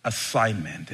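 ordinary talk

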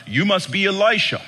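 lightly voiced grand announcement; pompous herald. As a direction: announce him grandly, building. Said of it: "the guy starts like".

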